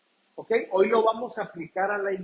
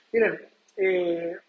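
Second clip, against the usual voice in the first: ordinary talk, nothing marked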